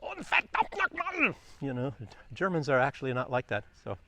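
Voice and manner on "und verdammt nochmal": hammed-up war-film German shout, much higher and slow; mock movie German. Vlogger mocking war-film Germans, hammed-up German bark. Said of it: "these movies from World War Two".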